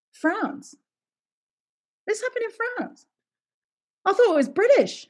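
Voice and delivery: high, baffled questioning voice; naive younger self. Retold or imitated. Actress learning the story is French, baffled and high. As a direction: play her clueless younger self, high and puzzled. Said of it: "I said".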